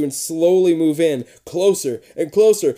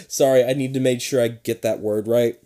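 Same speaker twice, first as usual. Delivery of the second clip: ordinary delivery, nothing marked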